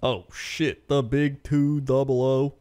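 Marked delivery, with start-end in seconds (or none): none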